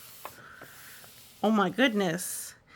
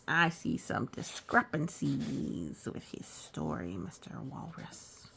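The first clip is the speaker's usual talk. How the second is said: mock suspicious; 5 semitones lower, 6 dB quieter, slower than usual. Reader teasing walrus about inconsistencies.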